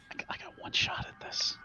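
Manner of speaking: unvoiced tense whisper. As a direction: breathe it under your breath. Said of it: "she's looking at Dickie like".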